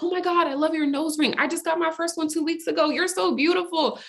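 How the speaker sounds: high-pitched imitation of a gushing stranger; lavish compliments on someone just met; acted delight, voice way up. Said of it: "And just like".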